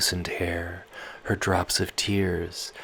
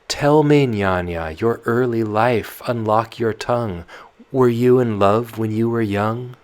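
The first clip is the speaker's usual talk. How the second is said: put-on loud, restless girl pleading with her nurse; Tatiana, sleepless and pleading; young Tatiana; 6 semitones higher, 9 dB louder than usual.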